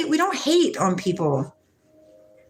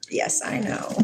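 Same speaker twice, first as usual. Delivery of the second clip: soft, knowing whisper; whispered reply; 6 dB quieter than usual. Retold or imitated. Mother whispers her answer to her son. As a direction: hush it back like a shared secret.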